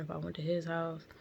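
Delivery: ordinary talk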